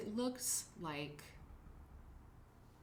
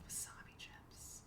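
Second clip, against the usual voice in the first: slow, much softer whisper; whispered aside; 17 dB quieter, slower than usual. Real, not acted. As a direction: breathe the words like a guilty secret. Aside about snacks.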